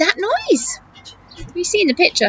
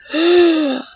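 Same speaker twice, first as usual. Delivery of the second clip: gasp. Acted. Reader gasps at story.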